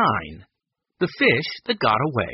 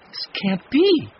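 high, slow, loud disbelief; stunned, raised voice; 12 semitones higher, 5 dB louder than usual. A narrator's reading. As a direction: draw it out high, unable to believe it. Kate finds the nets empty.

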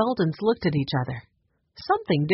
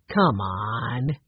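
pleading; 6 semitones lower than usual. A narrator's reading. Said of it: "Ray pleaded".

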